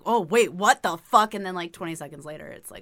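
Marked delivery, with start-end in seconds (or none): 0.1-1.3 loud, drawn-out mock disbelief; hammed-up double take; mock alarm. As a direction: imitate a startled guy, loud and slow. Guys' reaction, acted out.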